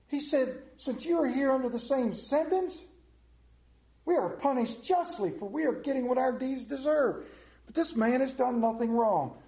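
loud, high, preaching scripture at full force; scripture reading. Acted. Preacher reading Luke to the church, loud and fervent. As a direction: proclaim the Gospel passage to the congregation.

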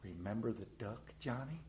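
low, hushed, sly reminder; quiet sisterly blackmail; sly hushed threat. Retold or imitated. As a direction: hiss the reminder under her breath. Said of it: "and go".

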